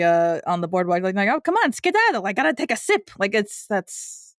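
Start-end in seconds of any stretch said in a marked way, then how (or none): 1.3-3.1 a lightly voiced Leona, pitched higher; a hint of a brassy aunt shooing a kid away; imitating Leona. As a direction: brush the kid off, then a quick aside about the drink. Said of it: "Leona with the kid on the boardwalk".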